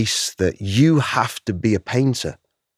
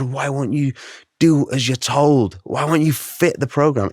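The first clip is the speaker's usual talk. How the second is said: retold angry outburst; 6 semitones higher than usual. Dad scolding his kids, retold exasperation. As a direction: replay the exasperated dad, pitched up. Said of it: "I was so mad".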